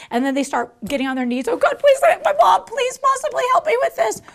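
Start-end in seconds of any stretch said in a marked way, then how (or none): 1.5-4.2 high, loud pleading; hammed-up high pleading kid; mock begging child. Children begging on their knees.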